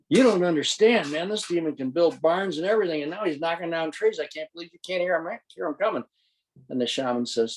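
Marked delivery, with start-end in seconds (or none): 0.1-6.0 high, panicked insistence; acted alarm, urgent and high; frantic protest. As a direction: protest desperately, pitched well up. Hunted man protests frantically to shaman.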